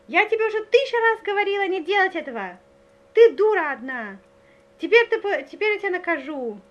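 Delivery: imitating a girl scolding her doll, loud and high; angry mock yelling. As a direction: play an exasperated mother yelling at her child. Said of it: "начинает орать. Не говорить, а орать на куклу".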